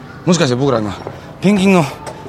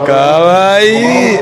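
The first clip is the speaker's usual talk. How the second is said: high, loud, slow "kawaii"; drawn-out cooing; 6 semitones higher, 6 dB louder, much slower than usual. Real, not acted. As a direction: gush over a cute animal. Cooing at the seal.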